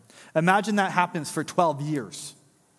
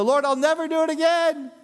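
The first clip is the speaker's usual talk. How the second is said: mock pious vow; 11 semitones higher, 3 dB louder than usual.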